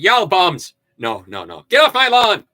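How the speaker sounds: hammed-up cranky old man shooing kids; mock old man yelling; grumpy old man.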